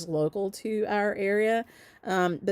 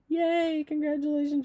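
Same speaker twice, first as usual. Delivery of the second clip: cheering; 8 semitones higher than usual. Real, not acted. Host congratulating Candy Gordon on winning, high cheering. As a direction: celebrate in a high, lifted voice.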